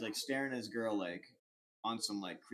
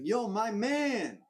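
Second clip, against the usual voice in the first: drawn-out street greeting; 9 semitones higher, 5 dB louder, much slower than usual. Retold or imitated. Stranger greets them on street.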